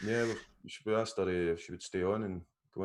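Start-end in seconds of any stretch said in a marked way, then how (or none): none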